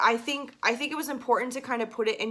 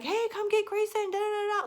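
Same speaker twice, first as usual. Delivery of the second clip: high strained call across the room; shouting across the room to someone; demo of straining to call out; 12 semitones higher than usual. Shown as a demonstration. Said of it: "even just doing that will put vocal strain".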